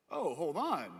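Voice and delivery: sudden intrigued double take; swooping, acted change of heart; suddenly interested. Rich person hears about ten million followers, suddenly intrigued.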